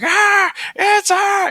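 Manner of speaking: high, drawn-out hammed-up scream; panicked scream. Imitating the man running from the house, a high hammed-up shriek. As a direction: shriek like a cartoon madman losing it. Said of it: "he's like".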